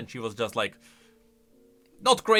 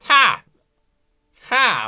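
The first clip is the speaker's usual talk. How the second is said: loud, high, single "ha" bursts; mock laugh; 9 semitones higher, 11 dB louder than usual. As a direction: imitate a laugh with one punched ha. Echoes the clip's short laugh.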